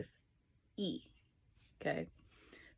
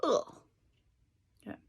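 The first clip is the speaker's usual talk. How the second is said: ordinary talk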